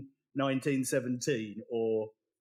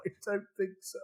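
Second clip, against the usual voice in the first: high, imitated tearful reply; mock tearful; 6 semitones higher, 4 dB quieter, faster than usual. Husband still crying, answering his wife, mock tearful. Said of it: "I still cry".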